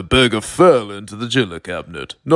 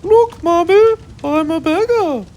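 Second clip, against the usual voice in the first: put-on very high squeaky little boy voice; proud little kid, high and bright-eyed; high child voice showing off; Timmy's voice; 4 dB louder than usual. A narrator's reading. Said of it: "and said".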